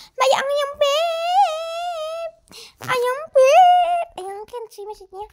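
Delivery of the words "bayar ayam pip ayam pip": silly high voice. Acted.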